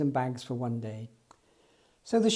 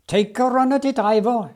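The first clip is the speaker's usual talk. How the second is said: higher, louder call to the engine; shouting; 6 semitones higher, 6 dB louder than usual. Acted. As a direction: urge the engine on with a big shout. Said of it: "shouted Jones".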